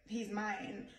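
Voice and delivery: ordinary delivery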